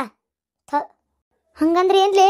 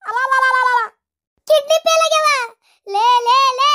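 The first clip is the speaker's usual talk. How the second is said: high, loud shocked wail, hands thrown up; alarmed wail; 9 semitones higher, 4 dB louder than usual. Acted. Woman wails in shock at kidney failure, high and loud. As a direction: cry out in horror at the news.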